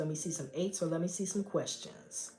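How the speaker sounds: talking between sips of tea; soft, half-away voice while drinking; sipping hot tea. Real, not acted. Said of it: "let me take a sip of my tea".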